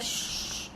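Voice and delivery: long airy shh for flying hair; imitated hair swish. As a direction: imitate a slow-motion commercial whoosh. Imitates slow-motion hair swish.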